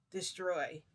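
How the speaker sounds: loud, firm command; commanding order. Retold or imitated. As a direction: bark the order to destroy. Boss ordering the kill.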